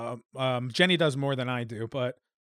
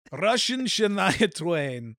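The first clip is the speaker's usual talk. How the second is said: slow, higher Russian-accented voice; mock Russian accent; 4 semitones higher, much slower than usual. Shown as a demonstration. Host names a new segment, mock Russian accent. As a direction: announce it drawn out, with a hammy Russian accent.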